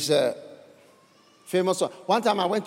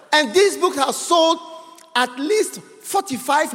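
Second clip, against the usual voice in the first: loud and higher, building indignation; preaching shout; 7 semitones higher, 4 dB louder than usual. Real, not acted. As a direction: hammer the critics from the pulpit. Preacher rebuking critics at full force.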